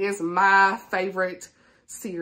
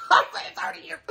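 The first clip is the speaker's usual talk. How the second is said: higher, laughing through the words; laughing exclamation; 8 semitones higher than usual. Real, not acted. Telling viewers she got the years wrong, laughing. Laughing at her own mistake.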